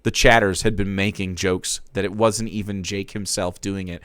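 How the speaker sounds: ordinary delivery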